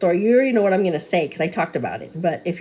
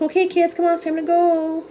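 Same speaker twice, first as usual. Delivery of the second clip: put-on much higher, bright hello to the children; sing-song kid greeting; 9 semitones higher than usual. Mom greeting her kids at daycare pickup, high sing-song hello. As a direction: greet toddlers in a high cheery voice.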